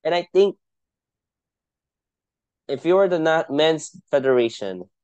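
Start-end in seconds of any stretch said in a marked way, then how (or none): none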